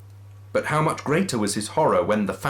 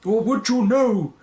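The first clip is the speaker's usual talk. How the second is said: ghostly wail; 12 semitones higher, 6 dB louder than usual. Acted. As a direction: intone it like a phantom. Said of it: "pursued the ghost".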